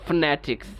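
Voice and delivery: "ফনেটিক্স" as fne-tiks, f running into n; quick elided pronunciation. Shown as a demonstration. Teacher drilling students to move quickly, "ফনেটিক্স" as fnetiks. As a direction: drop the first vowel of "ফনেটিক্স". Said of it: "দ্রুত বলবো".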